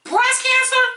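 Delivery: high, loud incredulous cry; outraged disbelief. Real, not acted. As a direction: shout it in disbelief.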